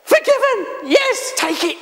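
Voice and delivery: God gladly shouting yes to the sinner; eager God. Acted. Preacher voices God offering forgiveness, eager and high. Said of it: "No, God goes".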